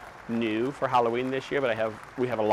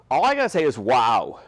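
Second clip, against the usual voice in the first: excited wow; 7 dB louder than usual. Real, not acted. Showing off witch figure.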